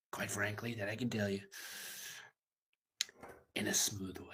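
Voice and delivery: breathy whispered aside; whispered punchline tacked on at the end; sly whisper. Acted. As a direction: drop to a secret whisper. Joking aside after bathroom talk.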